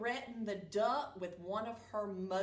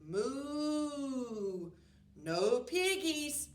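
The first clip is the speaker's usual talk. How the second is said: lowing moo, then cow voice; 3 semitones higher, much slower than usual. Acted. Cow mooing its answer to the witch. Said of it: "mooed the cow".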